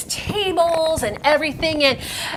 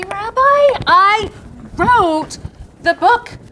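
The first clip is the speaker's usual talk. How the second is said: loud insistence; 6 semitones higher, 4 dB louder, much slower than usual. Acted. Insisting to the rabbi.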